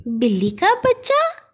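can't believe her ears; surprised. Acted. Sister asks Gaggu, slowly, in mock surprise. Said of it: "حیرت سے کہا".

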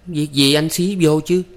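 high, annoyed retort; annoyed frown. A narrator's reading. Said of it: "Hai Thắm nhíu mày". (giọng cao, khó chịu)

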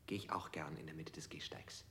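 whisper. Acted. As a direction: drop to a secret whisper. Answer in reply to question.

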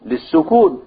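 ordinary talk

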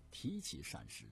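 ordinary delivery, nothing marked

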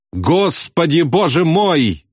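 high, drawn-out cry of disbelief; agonized amazement. A narrator's reading. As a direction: exclaim it, appalled and stunned. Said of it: "воскликнул Ясариан в мучительном изумлении".

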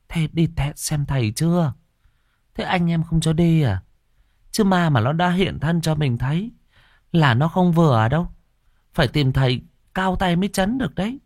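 high-pitched puzzled questioning; questioning sister. A narrator's reading. Sister advising, horrified.

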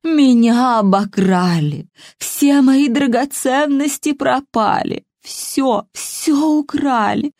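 put-on slow, wailing old woman; sobbing. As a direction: cry out the loss in sobs. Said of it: "Старуха рыдала басом".

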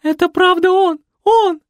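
high, loud, ecstatic disbelief; squealing fan. A narrator's reading. Nikoletta shrieking with joy at seeing Fred. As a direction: gush like a starstruck fan.

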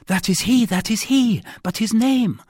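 high, loud, urgent heroine; excited recognition. A narrator's reading. Abducted wife recognises the man her rescuer describes, excited. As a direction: cry it out high and insistent.

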